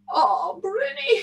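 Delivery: high, slow wail; plaintive longing. A narrator's reading. Tam misses his cow while eating, plaintive. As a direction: draw out the name yearningly.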